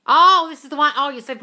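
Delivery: loud, high burst of recognition; sudden realisation. Real, not acted. Host suddenly placing a viewer's story, loud realisation. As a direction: exclaim it as it clicks.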